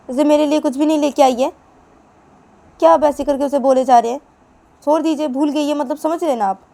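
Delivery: loud, high angry tirade; furious scolding. Acted. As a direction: tear into him, rising to a shriek. Mother berates father loudly.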